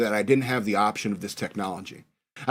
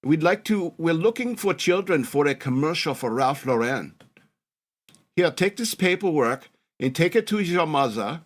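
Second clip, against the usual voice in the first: put-on European lady's accent; 2 semitones higher than usual. European lady approaches a kid about a commercial, mock German accent. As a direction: put on a German woman's accent, a touch sing-song. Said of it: "European-sounding lady come and approach me".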